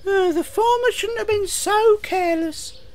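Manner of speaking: very high little engine voice; squeaky engine grumble; Thomas. Acted. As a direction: pipe it up high, disapproving. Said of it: "said Thomas to the fire engine".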